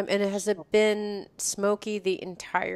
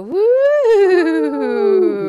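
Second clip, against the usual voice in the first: high loud whoop; excited cheer; 11 semitones higher, 8 dB louder than usual. Real, not acted. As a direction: cheer it out big. Excited cheer on podcast intro.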